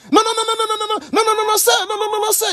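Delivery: high panicked pleading no. Retold or imitated. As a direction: beg him not to shoot, voice way up. Livreur paniqué, cris. (non paniqué, très aigu)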